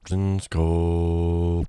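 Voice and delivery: slowing down like a stopping tape. Shown as a demonstration. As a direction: pitch winds down as the tape stops. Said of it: "this tape stop effect".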